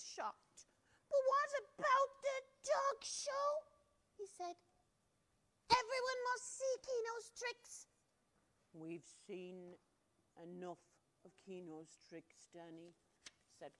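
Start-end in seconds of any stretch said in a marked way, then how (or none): 1.1-7.9 put-on high pleading child voice; much higher child voice; boy's protest. Boy protesting to family. 8.7-12.9 put-on low, soft stern old man; grandpa. Grandpa scolding.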